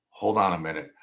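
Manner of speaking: usual speaking voice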